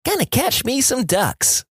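put-on high, slow kid; kid's voice. Kid answers old man on porch, high child voice. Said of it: "says the kid".

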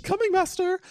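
very high, squeaky servant reply; mock servant. Friend answering a stage command, squeaky mock servant. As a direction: answer like a hammed-up obedient minion.

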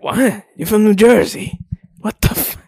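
incredulous Jersey guy. Retold or imitated. Jersey local scolding a [name] hater, high and incredulous. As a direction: pitch it up, stunned and offended. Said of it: "they're like".

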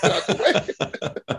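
high, slow, breathy laugh through the words; laughing mock plea. Defenders fleeing Derrick Henry, laughing high and slow. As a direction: squeak it out while laughing. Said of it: "I'm like".

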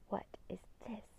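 hushed, breath-only wonder; stunned, very soft question; awed whisper. A narrator's reading. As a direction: whisper it in amazement. Man stares at book, wide-eyed.

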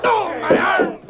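high, loud yelp of pain; sudden wounded cry. Acted. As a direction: cry out as the bullet hits. Shot in the arm.

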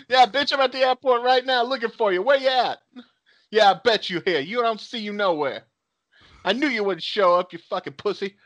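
mock taunting. Pretend call from the airport, mock taunting. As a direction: play a cocky challenger calling out a no-show.

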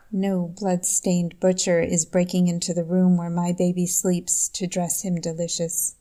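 normal talking voice

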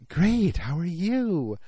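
drawn-out, high, cooing mock flirtation; flirty. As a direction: imitate a gushing flirt, stretching every word slowly. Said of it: "you know, very flirty".

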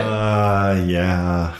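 hesitant, drawn-out agreement; unconfident. Real, not acted. Co-host asked if it's once a day, sounding unsure. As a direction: answer as if not quite sure. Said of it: "You don't sound so confident".

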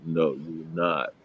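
lightly voiced parental refusal, slow and low; disapproving parents. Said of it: "my parents was just not into that".

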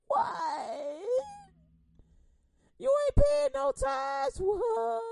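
high, loud and slow jeer; mocking yell. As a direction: sneer it out at full volume. Mock taunt continues.

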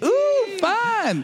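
put-on high, squealing kid delight; excited poor kid. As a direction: squeal it like a thrilled child. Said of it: "this poor kid that's just like".

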